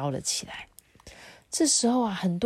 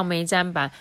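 ordinary delivery, nothing marked

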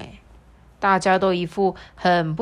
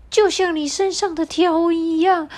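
much higher, pushy parrot; 11 semitones higher, 4 dB louder than usual. Acted. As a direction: squawk the demand up high. Parrot demands a decision.

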